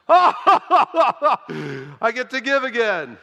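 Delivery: loud, high hammed-up laughing joy; holy laughter. Giver as the offering goes by, laughing hilariously. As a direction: burst into big mock guffaws, then exult. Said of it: "a time of holy laughter".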